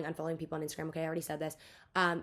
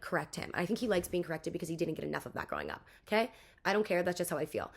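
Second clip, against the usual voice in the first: usual speaking voice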